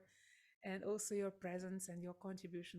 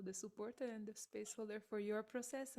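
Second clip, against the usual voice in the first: ordinary delivery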